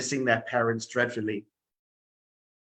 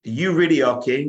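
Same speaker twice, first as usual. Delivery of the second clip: slightly raised, louder challenge; lightly voiced insistent boy; boy questioning a king; 4 semitones higher, 7 dB louder than usual. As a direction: ask it boldly as a young boy. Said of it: "the boy said to the king".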